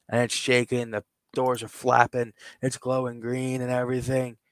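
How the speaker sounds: usual speaking voice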